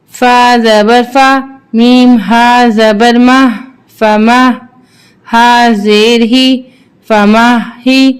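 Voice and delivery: slow, measured chant with long held vowels; Qur'anic spelling recitation. Shown as a demonstration. Teacher spelling verses for learners, measured chant. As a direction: intone each letter, then blend it.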